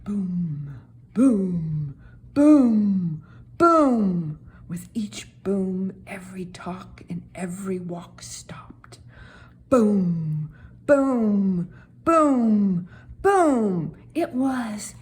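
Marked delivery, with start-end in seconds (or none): 0.0-4.4 building booms, louder each step; booming footsteps. A narrator's reading. Narrator sounds the giant's steps growing louder. 4.7-9.0 soft hushed whisper. A narrator's reading. Narrator hushes after the giant's steps. 9.7-14.0 building booms, louder each step; booming footsteps. A narrator's reading. Narrator sounds the giant's steps growing louder.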